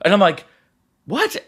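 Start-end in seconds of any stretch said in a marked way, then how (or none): 1.1-1.4 surprised. Retold or imitated. Surrounded by staff, disbelief.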